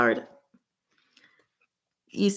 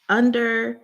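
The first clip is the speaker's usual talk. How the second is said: excited guess; 3 semitones higher, 6 dB louder than usual. Real, not acted.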